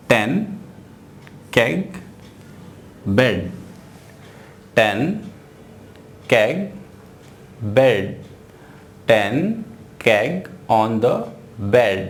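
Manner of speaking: very slow, word by word; slow reading. Shown as a demonstration. Teacher reads the example slowly to students. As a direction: read ten keg on the bed slowly. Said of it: "reads example slowly".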